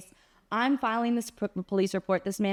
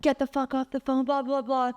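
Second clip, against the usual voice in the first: a hint of the angry guy, voice pitched up; lightly voiced aggressive man; half-hearted imitation of the jerk; mock angry guy; 5 semitones higher than usual. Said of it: "repeating what this crazy person said".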